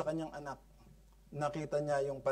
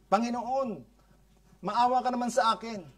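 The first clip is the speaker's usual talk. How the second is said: higher, pressing begging voice; insistent plea; 7 semitones higher, 4 dB louder than usual. Acted. Insistent mother demanding mercy from the Lord, high pleading. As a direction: push hard for mercy. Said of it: "she insisted mercy".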